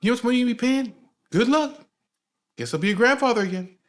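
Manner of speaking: high, loud exasperated ranting; mad. Retold or imitated. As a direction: imitate a fed-up father scolding his son. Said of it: "he would always get mad".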